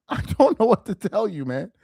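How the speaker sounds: normal talking voice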